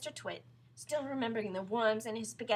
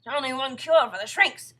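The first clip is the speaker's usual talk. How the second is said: loud, raised announcement; portentous husband; 4 semitones higher, 17 dB louder than usual. Acted. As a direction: dangle the only remedy. Husband announces cure in story.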